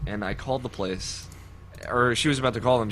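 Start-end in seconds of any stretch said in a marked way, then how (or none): none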